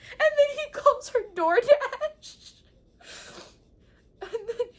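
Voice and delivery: high, loud laugh through words; squealing with laughter. Real, not acted. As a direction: crack up while telling it.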